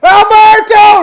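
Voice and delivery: high, full-volume yell of a name; crazed screaming. Retold or imitated. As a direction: imitate a man going crazy shouting. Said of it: "he's just screaming".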